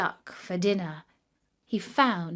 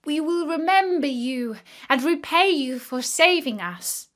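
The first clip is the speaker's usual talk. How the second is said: fish crying out; 5 semitones higher, 4 dB louder than usual. A narrator's reading. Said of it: "cried to him".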